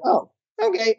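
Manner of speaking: a squeaky, taken-aback okay; startled little yelp. Retold or imitated. Owner answering his mentor's rebuke, in a startled squeak. Sheepish reply after mentor's scolding.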